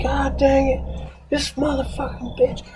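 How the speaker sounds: high-pitched, hammed-up cursing; mock angry yell. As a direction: imitate an exasperated yell without full volume. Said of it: "he'll yell at him".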